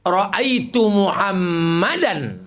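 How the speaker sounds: very slow, each syllable drawn out; slow demo. Shown as a demonstration. Preacher slowly demonstrating Arabic grammar to the congregation, drawn out. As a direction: spell out Muhammadan slowly for the class. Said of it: "Mana ada bahasa Inggris i'rab?".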